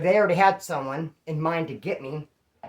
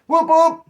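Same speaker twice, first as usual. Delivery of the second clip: loud, high double whoop; celebratory whoop; 12 semitones higher, 11 dB louder than usual. Acted. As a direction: whoop it out like a cheer. Celebrating being called to leave.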